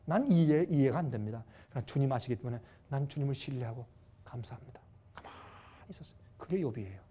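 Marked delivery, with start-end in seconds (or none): 0.0-4.8 low, hushed, trusting prayer; softly submit to God without complaint; uncomplaining prayer. Retold or imitated. Job prays after losing everything, quiet uncomplaining trust. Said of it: "불평 원망 안 했어요". 5.1-6.2 barely audible, whispered narration; hushed stillness. Real, not acted. Preacher tells how Job kept still, whispered.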